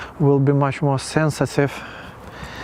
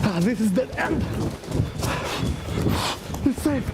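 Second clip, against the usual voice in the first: breathless relief; 9 semitones higher, 6 dB quieter than usual. Real, not acted. Relief after the chase, high and breathless.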